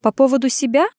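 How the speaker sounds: lightly derisive query; snorting retort. A narrator's reading. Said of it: "фыркнула она".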